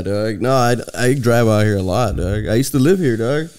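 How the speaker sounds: ordinary talk, nothing marked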